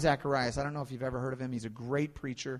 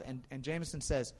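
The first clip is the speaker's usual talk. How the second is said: ordinary talk, nothing marked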